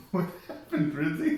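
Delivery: high, small pleading voice, breaking into laughter; high-pitched jokey plea; mock plaintive question. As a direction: ask it in a high, pained mock whine. Mock appeal to the film's director.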